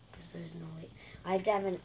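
whispered under breath. Real, not acted. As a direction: whisper it aside. Unvoiced whisper heard.

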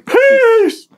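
high, loud outro shout; shouted sign-off. Real, not acted. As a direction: yell the goodbye out.

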